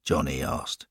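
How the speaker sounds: ordinary delivery, nothing marked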